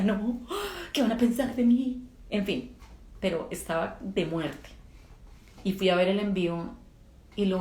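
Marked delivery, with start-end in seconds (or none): 0.0-2.0 panicked worry. Retold or imitated. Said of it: "Y yo decía".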